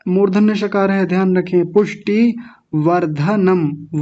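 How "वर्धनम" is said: slow वर्धनम as var-dha-nam; full dha. Shown as a demonstration. Teacher modelling for the class, slow वर्धनम with full dha. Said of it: "वर्धनम नहीं".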